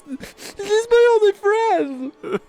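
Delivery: high, loud sobbing protest; crying son defending his only friend; crying plea. Acted. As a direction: plead through tears. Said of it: "I was crying now".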